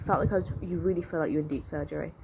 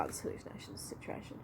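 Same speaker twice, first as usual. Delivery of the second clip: whisper; 16 dB quieter than usual. Retold or imitated. Quoting herself to the teacher.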